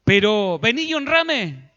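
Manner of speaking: demanding Saul. Acted. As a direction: switch from sorry to demanding.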